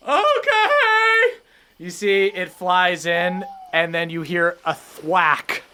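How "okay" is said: high squeaky seagull okay; gull voice. Acted. GM as the gull agreeing to the player, high squeak. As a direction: chirp it like the gull.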